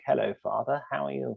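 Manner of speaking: prim, soft British-kid voice, a hint of haunted-movie child; creepy kid. Son greeting his dad as a creepy movie kid. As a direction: say it like the eerie child from a horror film. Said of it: "sounds like a creepy kid who'd haunt you".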